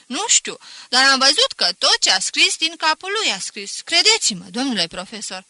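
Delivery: put-on loud, earnest boy defending his classmate; a schoolboy pleading hard for a friend. Student pleading to the teacher.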